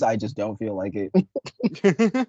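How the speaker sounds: slow, lazy and unbothered; lazy drawl. Acted. Admitting to viewers he's too lazy, drawled slowly. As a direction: drag it out like you can't be bothered.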